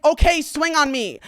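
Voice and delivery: high, loud dare; retold goading. Daring the guy who wants to fight, mock-combative.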